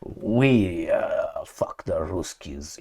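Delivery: very low, hushed, slow portrayal. Acted. Mock president talks of the Ruskies, hushed role-play. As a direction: play a leader talking low and slow, almost under the breath. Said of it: "This is the same portrayal of J F K with Khrushchev".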